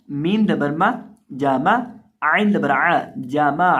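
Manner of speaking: loud, chanted letter-by-letter drill; Quran spelling recitation. Acted. Teacher chanting the letters so students repeat.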